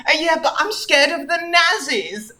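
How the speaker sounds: put-on high, plaintive girl's voice; a touch of timid protest, pitched well up; mildly scared, near tears. A narrator's reading. Anne Frank admits her fear to Sonic, high and mildly tearful. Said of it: "almost ready to cry".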